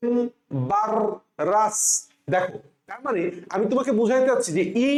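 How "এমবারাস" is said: এমবারাস very slowly as em, barr, ras with pauses; say-along pronunciation. Shown as a demonstration. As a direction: break এমবারাস into three long beats. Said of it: "আমার সাথে উচ্চারণ করো এইভাবে".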